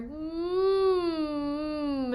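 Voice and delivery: high hum. Shown as a demonstration. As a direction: let a high hum ring. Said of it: "with the high hums".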